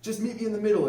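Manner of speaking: a high, loud mock thick German accent. German soldier calling to the British in a thick German accent. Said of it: "in a very thick German accent".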